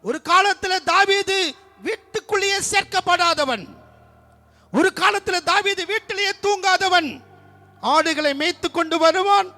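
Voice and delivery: loud, high fervent preaching; full-force preaching. Acted. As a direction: proclaim it to the whole church. (உரத்த குரலில் ஆவேசமான பிரசங்கம்)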